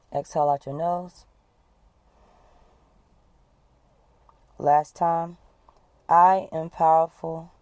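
steady, firm breathing coach leading the group; guided breathing exercise. Acted. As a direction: talk them through each breath and hold.

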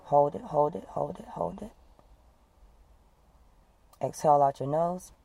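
steady, firm breathing coach leading the group; guided breathing exercise. Acted. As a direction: talk them through each breath and hold.

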